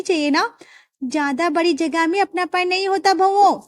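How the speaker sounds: high, sweet daughter-in-law asking a favour; young daughter-in-law. Acted. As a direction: coax the father-in-law for a bigger kitchen. Daughters-in-law argue for space.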